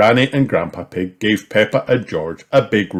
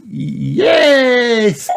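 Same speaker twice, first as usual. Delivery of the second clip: high, loud happy cry; excited cry; 6 dB louder than usual. A narrator's reading. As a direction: cry out with joy. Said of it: "cried Peppa".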